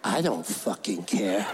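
very low, slow, uncaring growl; cold bully kid. Retold or imitated. Bully to kid needing a seat, low cold dismissal.

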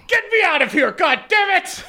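a noblewoman screaming for rescue; furious shriek. Acted. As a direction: screech the order, half laughing. Whitlock yells at her goons.

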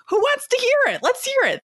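eager challenge. Retold or imitated. Her bold new self challenging critics, gleeful and high. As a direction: bring it on, inviting the fight. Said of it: "And now I'm like".

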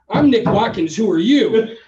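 retelling a heated call, a loud defiant reply; mock defiant retort. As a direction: throw your name back at her like a challenge. Retold phone quarrel with tenant.

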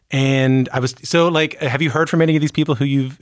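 ordinary talk, nothing marked

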